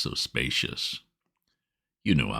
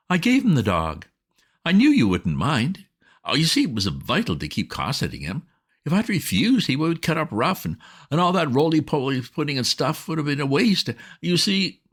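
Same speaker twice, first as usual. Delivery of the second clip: put-on higher, bright-eyed wheedling girl's voice; coaxing young woman; 9 semitones higher than usual. A narrator's reading. Bobbie breezily justifies herself.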